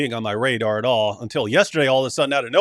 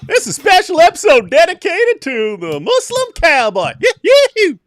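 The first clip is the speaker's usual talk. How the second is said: put-on Southern accent; 12 semitones higher, 7 dB louder than usual. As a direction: announce the rodeo in a Southern accent. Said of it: "Please do the stream in a Southern accent".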